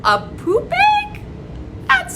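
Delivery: very high, loud shocked question; silly surprise. Acted. Teacher acting shocked at a poop egg with silly surprise. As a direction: squeal it like it's hilarious.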